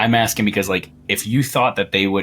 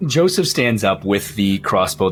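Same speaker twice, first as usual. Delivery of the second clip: normal talking voice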